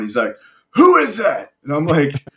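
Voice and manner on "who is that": high, loud mock bullhorn shout; bullhorn challenge. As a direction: call it out like an instructor on a bullhorn. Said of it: "comes up on a Zodiac with a bullhorn".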